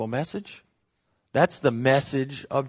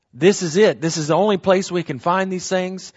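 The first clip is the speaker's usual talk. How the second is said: higher and louder, only hit hard; emphatic; 5 semitones higher, 4 dB louder than usual. Real, not acted. Preacher insists to the class this is the only place, emphatic and raised. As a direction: proclaim it, pushing up on only. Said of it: "There's an emphasis here".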